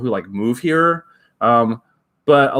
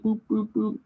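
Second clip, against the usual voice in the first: high boop sounds; 10 semitones higher, 7 dB quieter than usual. Acted. Booping the suit's nose.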